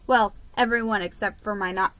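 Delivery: ordinary delivery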